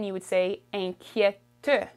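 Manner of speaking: usual speaking voice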